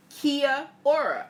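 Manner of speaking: "Kia ora" said slowly as KEE-uh OR-uh, syllable by syllable; careful pronunciation. Shown as a demonstration. Host trying out the Maori greeting for viewers, careful pronunciation. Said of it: "I don't know if I'm pronouncing that right".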